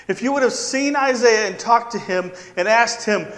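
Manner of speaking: ordinary delivery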